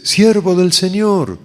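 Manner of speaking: high, very loud, stretched-out holler; shouted call. Retold or imitated. Voice calls him a second time as he walks, full shout. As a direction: shout it long and high to reach someone far off. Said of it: "una voz que grita".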